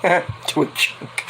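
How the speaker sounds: quiet giggling words; laughing through it. Retold or imitated. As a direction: laugh as you speak. Laughing while quoting.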